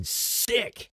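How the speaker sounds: ordinary talk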